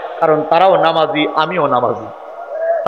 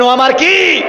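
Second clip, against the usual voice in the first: mock shout; 10 semitones higher, 3 dB louder, slower than usual. Preacher mocks shouting opponent.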